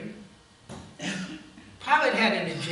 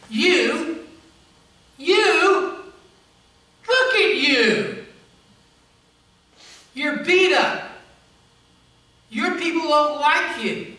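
scornful, louder mocking voice; contempt; 5 semitones higher, 7 dB louder than usual. Pilate sneering at the beaten Jesus with loud contempt. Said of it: "You can almost hear the contempt".

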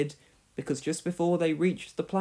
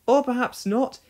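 lamely; 8 semitones higher, 4 dB louder than usual. Acted. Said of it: "he finished lamely".

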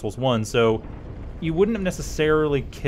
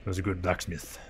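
quiet, lower Cornish blacksmith voice; Cornwall accent; 6 semitones lower, 12 dB quieter than usual. Retold or imitated. Gamer mourning the giant blacksmith, in a Cornwall accent. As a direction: mumble it like a Cornwall smith. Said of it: "No, I got it right".

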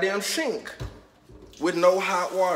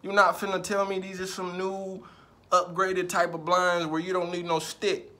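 normal talking voice

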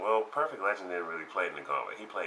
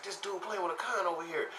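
amazed crowd; 11 semitones higher, 4 dB quieter than usual. Retold or imitated.

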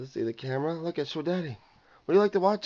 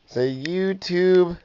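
slow coaxing; much slower than usual. Shown as a demonstration. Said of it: "Look at me and say it".

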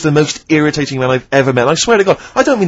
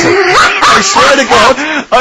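laughing; 9 semitones higher, 4 dB louder, much slower than usual. Real, not acted. Laughing about testicular cancer.

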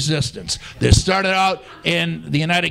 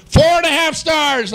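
game show host; 8 semitones higher, 4 dB louder than usual. Acted. First announcement of the score.

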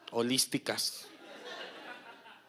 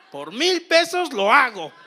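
higher, louder laughing eager yes; eager mock yes; 8 semitones higher, 9 dB louder than usual. The masseuse eagerly agreeing for a thousand pesos, laughing loud. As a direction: sell it like a happy hustler.

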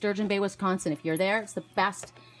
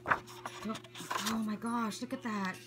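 soft, slow murmurs of wonder; real hushed amazement; hushed awe; 10 dB quieter, slower than usual. Real, not acted. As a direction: marvel quietly, taking your time. Awestruck while looking through gift book.

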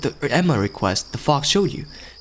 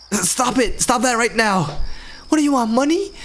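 much higher, panicked pleading; terrified shriek; 9 semitones higher than usual. Acted. A cat-phobic groom screams at the cat, terrified. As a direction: beg the cat to stay away in terror.

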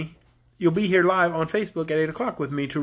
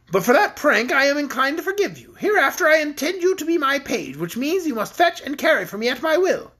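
higher-pitched, pleased queen laying down orders; cheerful queen; 8 semitones higher, 3 dB louder than usual. A narrator's reading. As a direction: lord it over a new page, pleased with yourself. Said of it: "in a cheerful tone".

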